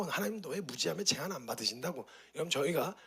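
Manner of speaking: usual speaking voice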